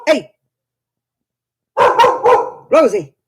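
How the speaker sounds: loud sharp call to the dog. Real, not acted. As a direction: shout across the room to stop her barking. Calling the barking dog.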